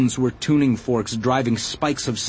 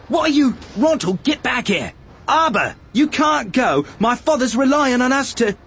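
high, strained calling after people; frantic protest; 12 semitones higher than usual. A narrator's reading. Arad calling back his fleeing workers, frantic protest. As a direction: plead and order at high pitch, cut off.

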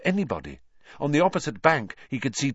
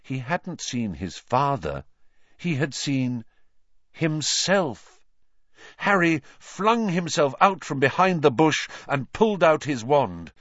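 usual speaking voice

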